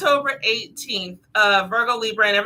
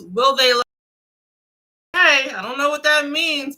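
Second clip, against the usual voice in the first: normal talking voice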